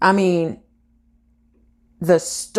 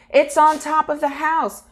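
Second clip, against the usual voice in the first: imitated neighbor's yell; 9 semitones higher than usual. Neighbor alerts others to car on house, high mock yell. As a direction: call it out high, like a startled neighbor. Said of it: "another neighbor yells".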